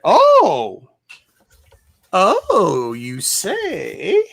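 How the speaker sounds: high exclamations with the voice cracking. Real, not acted. Collector exclaiming over a comic find, voice cracking. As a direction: let the voice break upward, loudly. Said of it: "Sorry, my voice is cracking".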